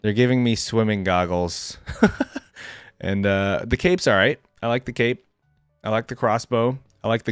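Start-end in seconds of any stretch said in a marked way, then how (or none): 1.8-2.4 brief amused laugh; amused at own joke, short laugh; short chuckle. Real, not acted. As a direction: let out a quick little chuckle. Amused by swimming goggles remark.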